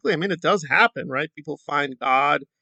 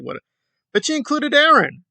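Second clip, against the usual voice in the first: ordinary delivery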